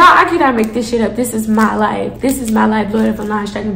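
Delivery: normal talking voice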